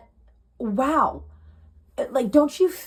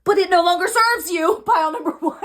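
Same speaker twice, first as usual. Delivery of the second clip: laughing emphasis; 6 semitones higher, 6 dB louder than usual. Real, not acted. Repeats line, laughing.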